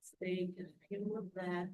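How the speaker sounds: calm breathing guide, unhurried; guided meditation. Acted. Leads a guided breathing relaxation.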